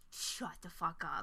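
slow, low, stunned disbelief. Retold or imitated. Host discovering the name was Bert, slow stunned disbelief. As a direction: let the disbelief drag out slowly.

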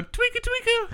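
very high squeaky chirp; squeaky sound effect. Pretend. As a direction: squeak it like a toy. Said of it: "Give me another sound effect".

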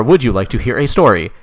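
normal talking voice

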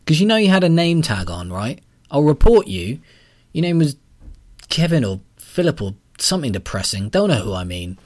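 angry threat. A narrator's reading. Callum threatens the caller with a report, loud.

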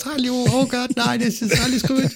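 high squeaky cartoon voice; Smurf voice. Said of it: "da kam lauter Schlümpfe wieder raus".

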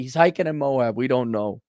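ordinary delivery

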